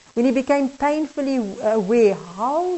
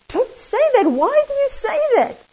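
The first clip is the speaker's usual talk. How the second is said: much higher, aghast protest; horrified plea; 13 semitones higher than usual. Acted. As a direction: blurt it out in shocked embarrassment. Horrified man scolds customer.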